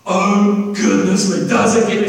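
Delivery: amazed angels. Acted. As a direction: burst out in wonder. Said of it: "Let there be".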